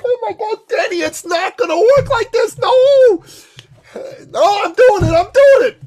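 very high and loud, laughing through it; exasperated laughing protest. Retold or imitated. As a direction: plead with him in disbelief. Exasperated reply to Dan.

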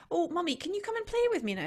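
put-on chirpy child asking to play; imitating her daughter. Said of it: "one of the things that she said to me was".

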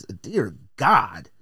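usual speaking voice

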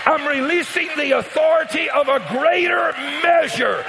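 high, loud preacher shout; shouted declaration. Acted. Pastor releasing authority over the congregation, shouted. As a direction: proclaim it to the whole house. Declaration then slowed repeat.

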